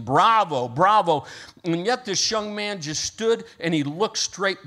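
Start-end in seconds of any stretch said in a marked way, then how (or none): 0.0-1.2 louder, higher mock cheers of bravo; acted standing-ovation cheer; cheering crowd. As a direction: call it out like an applauding audience. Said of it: "people are standing up and saying".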